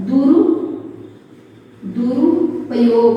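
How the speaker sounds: slow, drawn-out word for dictation. Shown as a demonstration. Teacher dictating the answer word, slowly. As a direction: stretch it syllable by syllable. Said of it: "क्या होता है?".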